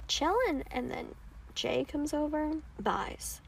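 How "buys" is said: normal talking voice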